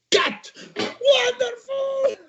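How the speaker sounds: very high, drawn-out, delighted cry of cut and wonderful; Stephen Frears delighted. Retold or imitated. The director calls the take to the crew with a drawn-out, high cry of joy. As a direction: sing out cut like a thrilled director. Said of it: "Stephen Frears fait".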